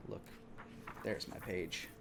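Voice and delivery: ordinary delivery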